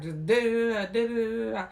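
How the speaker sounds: loud high babbled nagging da da; mock nagging chatter. Mimicking the wife rattling on at her husband, mock nagging chatter. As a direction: rattle off the wife's nonstop talk. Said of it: "She like".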